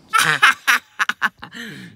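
high, loud burst of laughter. Real, not acted. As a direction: cackle out loud. Bursts out laughing at own joke.

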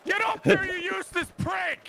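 high furious shout; real rage at a useless player; angry yell. Real, not acted. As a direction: bark it at the teammate. Raging at teammate mid-match.

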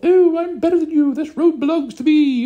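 high, loud, smug squeal; hammed-up posh cyclist sneer; mock snooty cyclist. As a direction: lord it over the road in a prissy voice. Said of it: "running around going".

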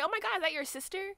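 mock polite gush. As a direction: gush it like flattering a mom. Polite fake compliment.

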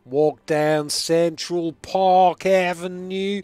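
loud, very slow mock New York drawl; bad New York accent. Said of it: "have you been to New York as well?".

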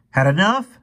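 high, loud snarl. A narrator's reading. Fergie to the kid he fought, snarling. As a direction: snarl it at a beaten opponent. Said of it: "snarled Fergie".

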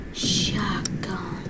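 whispered afterthought. Real, not acted. Gamer whispering a second option. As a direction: breathe it out softly.

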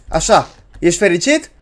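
ordinary talk, nothing marked

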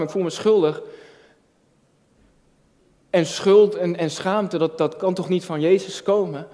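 ordinary talk

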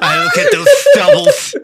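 squealing with laughter; laughing high. Real, not acted. Player laughing at the halfling reveal. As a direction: talk through a burst of high laughter.

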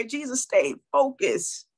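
usual speaking voice

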